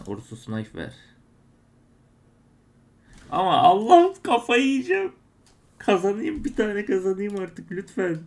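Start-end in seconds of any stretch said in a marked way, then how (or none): 3.3-8.2 much higher, loud wailing plea; desperate pleading. Real, not acted. Streamer begging the case site for a win, loud high desperate wail. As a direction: cry out to God for just one win.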